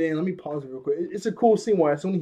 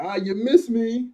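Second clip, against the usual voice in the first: higher, louder, slow teasing taunt; cocky taunt; 6 semitones higher, 6 dB louder, slower than usual. Retold or imitated. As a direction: taunt the attackers playfully. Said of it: "He's like".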